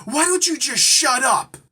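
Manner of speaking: loud, high mock outburst; imitated screaming. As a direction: imitate an opinionated girl yelling at a stranger. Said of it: "she's screaming at me".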